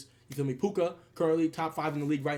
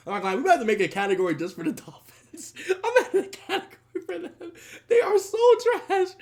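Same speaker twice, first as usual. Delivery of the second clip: crying with laughter, higher; laughing hard; 12 semitones higher, 6 dB louder than usual. Real, not acted. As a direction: laugh till it almost sounds like tears.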